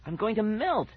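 high, desperate thought; panicked. A narrator's reading. Boy overheating in the dark, panicked.

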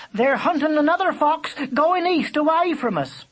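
high, loud bird call. A narrator's reading. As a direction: call down the news from above. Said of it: "she called".

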